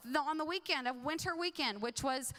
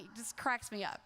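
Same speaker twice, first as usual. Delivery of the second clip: ordinary talk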